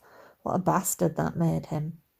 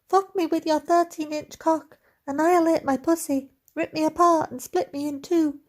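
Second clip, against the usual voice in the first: high-pitched mock heroine voice; squeaky falsetto; 10 semitones higher than usual. A narrator's reading. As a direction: keep squeaking, over the top. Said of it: "he squeaked".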